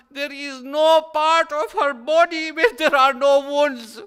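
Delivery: loud, high, crying lament; wailing lament. Acted. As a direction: let the grief burst out over her wounds.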